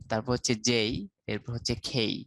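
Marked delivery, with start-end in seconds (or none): 0.5-0.9 যেই with a glide to "ee"; gliding diphthong in যেই; British J. Shown as a demonstration. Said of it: "phonetics". 1.9-2.1 খেই with puffed k and "ey" glide; British-style aspirated খেই. Shown as a demonstration. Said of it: "phonetics".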